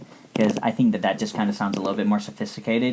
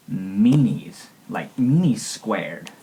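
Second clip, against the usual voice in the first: pouty emphasis; much slower than usual. Pretend. Childish insult for occupiers.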